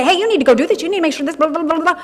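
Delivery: mock the demanding world; the pushy world. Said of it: "The world might say".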